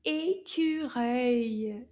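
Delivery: "écureuil" stretched out very slowly, syllable by syllable; slow model word. Shown as a demonstration. Teacher showing kids how to say squirrel, slow. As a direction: draw out "écureuil" slowly for little learners.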